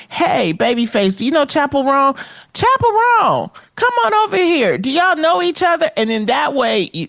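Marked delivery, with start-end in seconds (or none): 0.1-2.1 lightly acted, higher-pitched interviewer greeting; interviewer introduction. Models a friendly red-carpet introduction. 2.5-5.9 high-pitched acted hail, quick after the name; calling someone over. Acts calling a second guest over.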